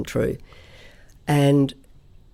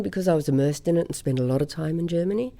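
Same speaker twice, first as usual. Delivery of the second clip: ordinary talk, nothing marked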